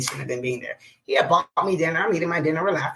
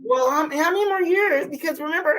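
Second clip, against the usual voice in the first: mock customer; 10 semitones higher than usual. Imitating the customer.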